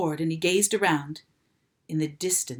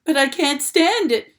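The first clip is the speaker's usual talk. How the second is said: loud, higher desperate shout; 8 semitones higher, 7 dB louder than usual. A narrator's reading. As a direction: yell it in despair. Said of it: "shouted Wilbur".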